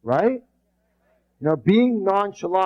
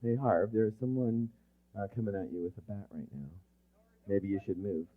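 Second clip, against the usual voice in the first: soft, lower, lazy murmur; calm buddy, no urgency; nonchalant warning; 7 semitones lower, 13 dB quieter, faster than usual. Shown as a demonstration. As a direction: shrug it off quietly. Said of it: "They go".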